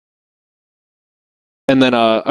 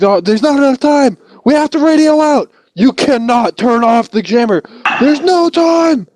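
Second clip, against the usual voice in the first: panicked soldier; 14 semitones higher than usual. Retold or imitated. Scout arguing with gunner about the jammer, panicked shout. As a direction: argue back in a panic. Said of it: "It's like".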